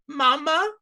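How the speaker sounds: put-on high, questioning child-creature voice; small high plea, lifting into a question; little sad creature. Sad little redcap looks up to Odelia, high and pleading. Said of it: "it looks up and it goes".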